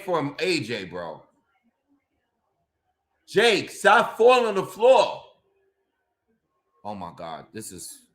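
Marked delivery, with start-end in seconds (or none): 3.3-5.2 louder, higher fed-up yell; exasperated shout. Real, not acted. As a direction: yell at Jake to get up. Shouting at fighter on stream.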